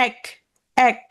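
এক, এক, each with an open "æ"; separate, careful "æk" for each এক; open vowel. Shown as a demonstration. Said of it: "এটা হচ্ছে বিবৃত".

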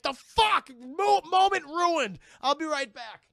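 loud, high mock outrage; hammed-up furious interruption; outraged ape. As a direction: bellow it, dragging out the words. Acting angry ape, interrupted.

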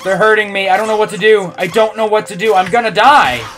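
high loud pleading; terrified. Acted. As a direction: beg as if in pain. Frightened character voice.

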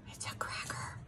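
breathy whispered gasp. Real, not acted. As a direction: whisper it like a secret treat. Offers bird a treat.

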